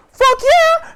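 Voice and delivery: very high, loud whoop; exuberant agreement. Retold or imitated. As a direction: whoop it in character. Acts the hero's eager reply.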